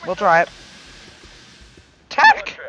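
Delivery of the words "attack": loud shout that cracks. Real, not acted. Player ordering an attack in game, cracking shout. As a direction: yell it till the voice breaks. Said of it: "Ah, voice crack".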